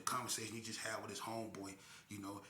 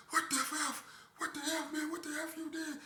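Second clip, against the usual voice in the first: much higher voice with a nervous laugh; stunned outburst; 5 dB louder than usual. Retold or imitated. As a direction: blurt out disbelief. Accomplice shocked in getaway car.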